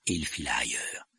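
ordinary delivery, nothing marked

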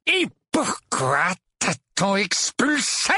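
slow, high angry interrogation; furious demand. A narrator's reading. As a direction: fire the question, stretching each word. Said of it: "un regard furieux puis lança". (question lancée avec fureur)

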